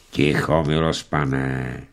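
low, soft, steady authority; calm but firm. A narrator's reading. Old servant calming the quarrelling masters, low and firm. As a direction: settle them with quiet firmness. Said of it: "zakročila rozvážně, ale s důrazem".

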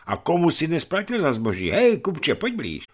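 high, challenging summons; calling out. A narrator's reading. Hunchback summoning a merchant from the crowd, calling out. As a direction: call the witness over, pitched well up.

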